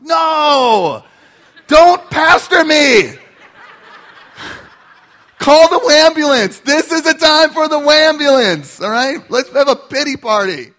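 loud, high, half-laughing hammed-up protest; mock outburst. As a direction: throw a dramatic tantrum at the wife. Arguing back at his wife.

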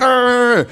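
high-pitched play-fight yell; mock fighting growl. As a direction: imitate two boys scrapping. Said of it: "we'd start fighting".

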